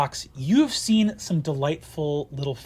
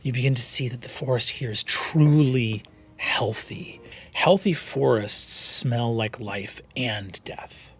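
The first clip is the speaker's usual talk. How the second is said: soft, low game master narration of the forest; hushed scene setting; 5 semitones lower, 7 dB quieter than usual. Acted. Game master to players, hushed scene-setting.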